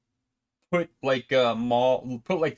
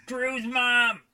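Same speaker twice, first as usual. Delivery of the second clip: high-pitched mock holler; imitating John calling out; 9 semitones higher, much slower than usual. Imitating a friend in a joke game, calling out high. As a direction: call out high, mimicking a friend's catchphrase. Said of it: "being like".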